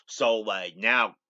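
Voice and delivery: ordinary talk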